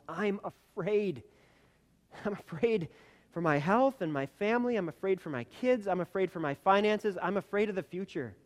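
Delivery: high, loud fearful outcry; frightened heart crying out. Acted. Voicing a frightened heart to the congregation, high and loud. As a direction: let the fear burst out, pleading. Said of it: "heart that's screaming at the top of its lungs".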